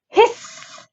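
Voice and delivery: high word trailing into a long hiss; goose hiss. A narrator's reading. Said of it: "said the goose".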